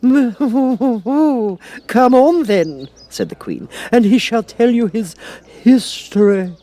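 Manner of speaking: high, slow royal voice; haughty queen. A narrator's reading. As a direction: talk down to her like a queen. The Queen commands Alice.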